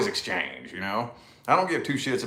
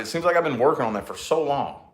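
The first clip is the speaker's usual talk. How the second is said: normal talking voice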